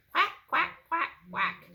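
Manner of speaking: high, loud duck quacking; Mama Duck voice. Acted. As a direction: call out like a mother duck. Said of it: "Mama duck said".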